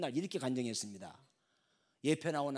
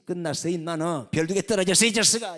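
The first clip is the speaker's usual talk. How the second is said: loud, higher jeering at a fool; taunting yell; 6 semitones higher, 13 dB louder than usual. Acted. Colleague jeering at the general who refused the drink: loud taunt. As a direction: shout him down.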